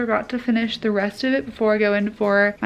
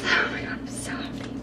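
breathless whisper; 8 dB quieter than usual. Real, not acted. Opening a package she hoped for.

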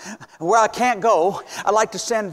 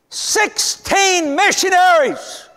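higher, louder and drawn out slowly; proud proclamation; 10 semitones higher, 4 dB louder, much slower than usual. Real, not acted. Preacher proclaiming the kits sent to the church. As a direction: proclaim it like good news.

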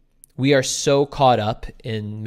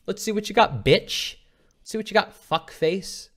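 taunting challenge; 7 semitones higher than usual. Acted. Imagined listener taunting a performer.

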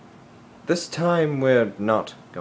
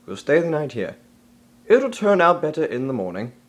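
normal talking voice

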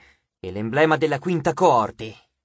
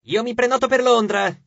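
a ghoul calling out higher and louder; shouted claim; 6 semitones higher, 4 dB louder than usual. A narrator's reading. A ghoul yelling from the next table, shouting. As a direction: shout it across the room. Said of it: "urlò un ghoul".

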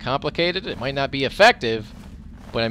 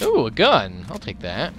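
normal talking voice

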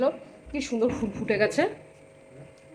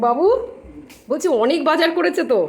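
much higher and louder call; 8 semitones higher, 9 dB louder than usual. Real, not acted. Mother calls to her son about the shopping, loud call. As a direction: call out across the house.

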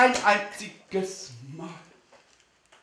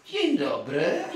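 Doda's greeting; slower than usual. Retold or imitated. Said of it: "dann wird Doda Elektroda sagen".

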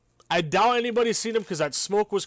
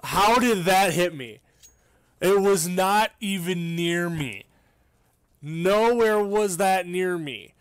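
raging gamer; 3 dB louder, slower than usual. Real, not acted. Raging at the hit on him.

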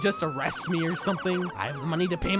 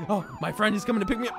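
ordinary delivery

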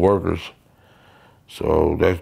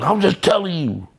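father warning; 9 semitones higher than usual. Retold or imitated. Father's reply, retold.

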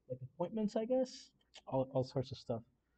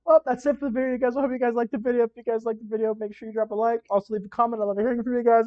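much higher, louder upbeat sign-off; bright energetic YouTuber outro, higher and louder; vlog outro; 7 semitones higher, 9 dB louder than usual. Acted. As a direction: pump up the viewers to like and subscribe.

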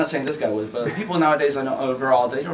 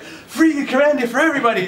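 much higher, excited mock cheer; 12 semitones higher, 4 dB louder than usual. Patient Russian fan celebrating the release, high mock cheer. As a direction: cheer it like a thrilled fan quoted.

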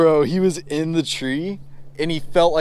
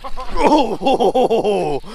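high laugh of delight; 9 semitones higher, 4 dB louder than usual. Real, not acted. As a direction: burst out laughing. Laughing at the fish.

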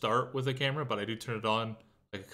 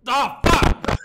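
very high, loud shriek of fright; startled scream; 17 dB louder than usual. Real, not acted. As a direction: scream the curse as if jumped at. Jump scare in horror game.

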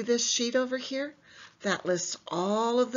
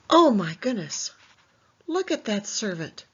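mock shock; 5 dB louder than usual. Teacher reacts to picture.

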